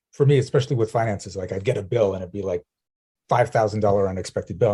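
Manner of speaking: usual speaking voice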